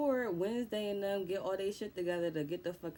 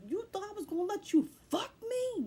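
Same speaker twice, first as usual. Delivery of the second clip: defiant retort; faster than usual. Retold or imitated. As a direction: throw it back at him in a high voice. Kiki after killing her attacker.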